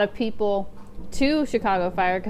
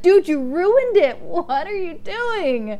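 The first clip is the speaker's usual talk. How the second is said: higher, louder, slow mock outrage; mock angry outburst; 9 semitones higher, 4 dB louder, slower than usual. Fan yelling at the chief for ruining the hug, mock outrage. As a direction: rail at the chief like a betrayed fan. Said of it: "that made me so angry".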